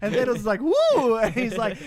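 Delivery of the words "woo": high-pitched little whoop; mock startled whoop. Thanos gets smooched on the butt, mock startled whoop. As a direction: whoop as if goosed.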